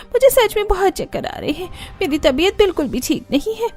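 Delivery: high, pleading fake-weak voice; feigning sickness. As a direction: whine like you're about to faint. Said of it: "बेहोश होकर सोफे पर गिरने का नाटक करती है". (बीमारी का नाटक करती ऊँची आवाज़)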